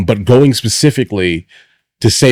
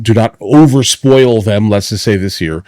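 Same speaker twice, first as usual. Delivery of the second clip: ordinary talk, nothing marked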